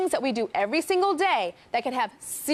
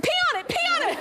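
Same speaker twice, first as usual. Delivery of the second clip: mock urging; 14 semitones higher, much faster than usual.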